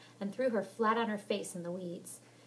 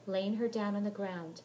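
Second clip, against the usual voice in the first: usual speaking voice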